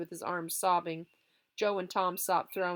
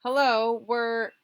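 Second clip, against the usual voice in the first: calling out; 5 semitones higher, 9 dB louder, much slower than usual. Acted. Boys calling out at night.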